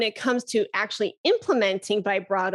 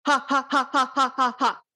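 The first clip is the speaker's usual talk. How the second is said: faster vibrato haws; 3 semitones higher than usual. Shown as a demonstration. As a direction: rattle the ha pulses off faster from the belly. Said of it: "And then you can speed it up".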